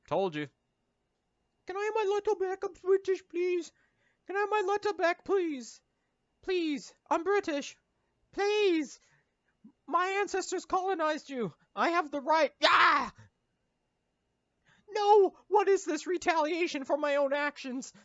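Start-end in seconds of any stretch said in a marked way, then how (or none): 1.7-12.5 put-on high falsetto posh-boy plea; squeaky British kid begging; mock British child. British character pleading in falsetto. 12.6-15.3 high, loud squeal of pain; mock scream. British child gets beaten.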